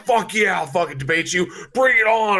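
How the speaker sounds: over-the-top shouted bravado; hyped-up challenge. Acted. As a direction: roar like you are spoiling for a fight. Imagines his hyped twenty-something self debating.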